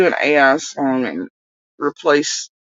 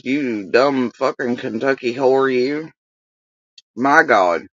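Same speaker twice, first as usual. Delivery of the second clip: ordinary talk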